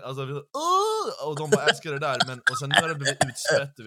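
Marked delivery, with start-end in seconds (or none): 0.3-1.3 high, loud mock "Åh!"; mock gasp. Mocking the fans, a high loud gush. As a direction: squeal it like a gushing fan. Mocking hiphop fans' reaction.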